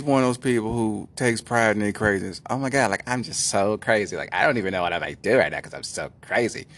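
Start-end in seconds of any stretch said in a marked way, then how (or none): 2.5-6.7 put-on crazy girl voice. Imitating a self-proclaimed crazy woman.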